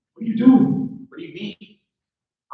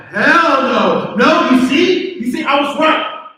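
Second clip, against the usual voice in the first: loud, higher "told you so" cry; triumphant shout; 5 semitones higher, 5 dB louder, much slower than usual. Acted. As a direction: crow it out, proven right. Kevin catches her, triumphant.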